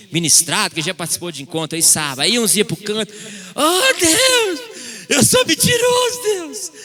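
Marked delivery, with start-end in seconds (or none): 3.6-6.8 mock crying confession. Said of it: "E chorando".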